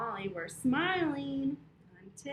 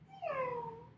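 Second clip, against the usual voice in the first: high cat meow; 12 semitones higher than usual. Shown as a demonstration. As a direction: meow like a kitten. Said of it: "Can I hear your cats again".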